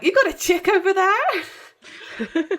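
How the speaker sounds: high, slow, giggling tease; teasing laugh. Retold or imitated. Dean ribbing Bobby about a woman, giggling tease. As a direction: tease him through a laugh.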